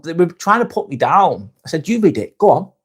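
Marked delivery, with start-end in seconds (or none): 1.9-2.7 higher-pitched dare, putting them on the spot; retold challenge. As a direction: re-enact calling a critic's bluff. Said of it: "I put them on the spot".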